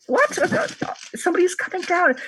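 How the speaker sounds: high startled cry from below; surprised high voice. Acted. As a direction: react startled to intruders.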